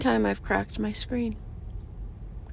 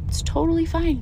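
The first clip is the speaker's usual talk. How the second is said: higher, lifted "totally" falling to "fine"; bright reassurance; 6 semitones higher than usual. Shown as a demonstration. Telling yourself your anger is fine, upbeat and higher. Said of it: "Yeah, talk to yourself".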